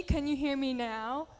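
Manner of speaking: normal talking voice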